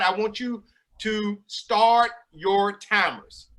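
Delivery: slow instruction. Real, not acted. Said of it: "magic trick host instructing".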